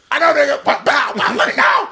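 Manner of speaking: high, loud mock yelling; frantic re-enactment. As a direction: shout through the crash, imitating both sides. Re-enacting the quarrel and crash.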